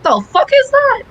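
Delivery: high, exasperated disbelief; shocked incredulity at students' ignorance; incredulous. Retold or imitated. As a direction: squeak out a baffled "what is that". Retelling her reaction to students.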